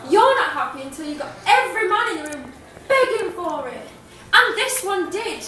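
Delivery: Patricia. Acted. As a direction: build the accusation, hitting key words hard. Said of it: "I'll be performing in the character of Patricia".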